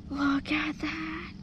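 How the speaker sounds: slow breathy whisper of admiration; awed whisper. Real, not acted. Angler admiring the smallmouth, in an awed whisper. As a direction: whisper as if the fish might hear.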